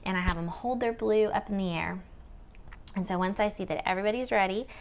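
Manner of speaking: normal talking voice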